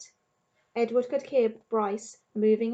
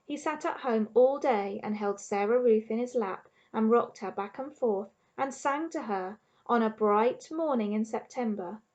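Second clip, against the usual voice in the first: ordinary delivery, nothing marked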